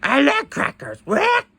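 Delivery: high squawky parrot voice; parrot squawk. Pretend.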